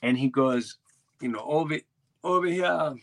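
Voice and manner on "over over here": put-on higher-pitched rough stab at a Bahamian accent; Bahamian guide's accent. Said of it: "whatever the, the accent, he's like".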